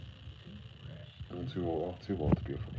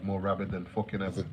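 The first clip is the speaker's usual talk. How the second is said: usual speaking voice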